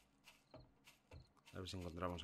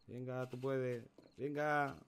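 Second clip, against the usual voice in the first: encouraging, drawn out and higher; animando; 5 semitones higher, 5 dB louder, much slower than usual. Real, not acted. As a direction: cheer the character on, slow and rising. Anima al personaje en el juego. (animando con ganas)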